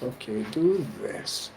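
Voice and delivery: quiet mock spirit instructions; gatekeeper's voice. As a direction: show the spirits giving directions quietly. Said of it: "they be like".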